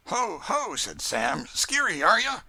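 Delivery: mock the skittish colt; high, playful taunt to a horse; Sam teasing. A narrator's reading.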